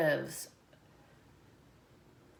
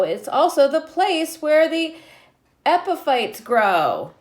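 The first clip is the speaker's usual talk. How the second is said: lightly lilting read-aloud; reading a picture book to children; 2 semitones higher, 8 dB louder than usual. Acted. Teacher reading the book to her class, lightly lilting.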